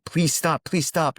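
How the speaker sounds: mock scream. Monk pleading with the oncoming boat, mock scream. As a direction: call out urgently, pitched well up, without full volume. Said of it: "he's screaming at whoever is on that boat".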